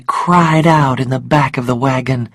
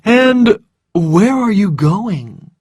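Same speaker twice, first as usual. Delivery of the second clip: drawn-out, courteous question; smooth, unhurried civil inquiry; polite; 5 semitones higher, 3 dB louder, slower than usual. A narrator's reading. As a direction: ask with slow, exaggerated politeness. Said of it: "he asked politely".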